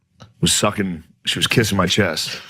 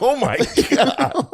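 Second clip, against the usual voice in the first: slow shocked exclaim; 13 semitones higher, slower than usual. Retold or imitated. Reacting to gnarled hand.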